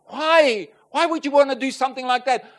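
loud, high cry of why to God; anguished why. Retold or imitated. Grieving husband crying out loudly at God. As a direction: throw the question at God, pained.

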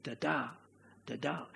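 "Da-da" soft and low, weak beat then strong; iambic beat. Shown as a demonstration. Preacher repeats the iambic foot for listeners, soft low pulse. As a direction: tap out "da-da" softly, leaning on the second beat. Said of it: "It's the iambic".

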